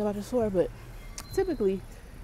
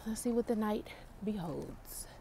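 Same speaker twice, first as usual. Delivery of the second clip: whisper; 14 dB quieter than usual. Real, not acted. Night shift whisper.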